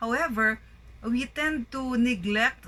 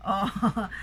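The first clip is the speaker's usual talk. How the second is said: ordinary talk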